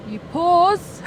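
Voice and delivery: American accent. Shown as a demonstration. Friend repeating "pause" in an American accent to be understood. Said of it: "I'm gonna say it in American accent".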